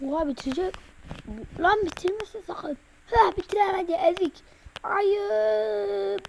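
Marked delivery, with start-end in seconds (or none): none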